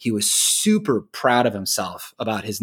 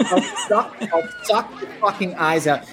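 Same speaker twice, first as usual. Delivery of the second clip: a laughing hint of Pesci; Pesci impression; 9 semitones higher than usual. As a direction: repeat the threat, still giggling, as Pesci. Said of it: "when he beats the fucking shit out of the guys".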